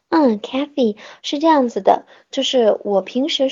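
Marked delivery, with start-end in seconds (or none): none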